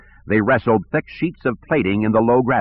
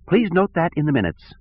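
lightly voiced petty director, higher; peevish, a touch higher; whiny bureaucrat; 9 semitones higher than usual. Sivron voice.

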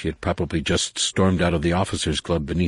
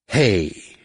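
loud call; 2 semitones higher than usual. A narrator's reading. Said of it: "called a loud voice".

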